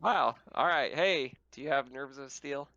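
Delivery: ordinary talk, nothing marked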